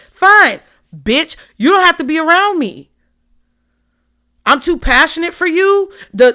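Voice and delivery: sassy retort. Acted. Pisces snapping back at friends who can't handle her, loud and sassy. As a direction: tell them off, you're done with them.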